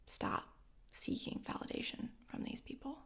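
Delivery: whispered, urgent advice. Acted. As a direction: lean in and whisper it. Advice delivered in hushed voice.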